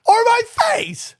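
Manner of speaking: slow, very high-pitched exclamation; incredulous. Real, not acted. Host agreeing the fan missed his face, incredulous. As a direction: draw it out in high disbelief.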